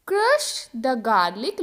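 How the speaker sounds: crush the garlic said very slowly; slow model sentence. Shown as a demonstration. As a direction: drill it slowly for the class. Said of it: "example देखो". (धीरे-धीरे उच्चारण)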